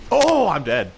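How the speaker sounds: loud shocked "oh, I'm dead"; surprised outburst. Real, not acted. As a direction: blurt it out in shock. Character dies suddenly.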